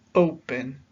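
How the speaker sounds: "Open" with the O hit harder; stress on first syllable. Shown as a demonstration. Teacher showing learners what stress is, first syllable of "Open" stressed. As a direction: punch the O in "Open", let -pen drop. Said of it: "pronunciamos más fuerte una sílaba".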